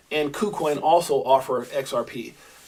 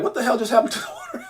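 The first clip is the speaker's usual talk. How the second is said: laughing dog voice; 6 semitones higher, 3 dB quieter, faster than usual. Retold or imitated. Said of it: "And they're like".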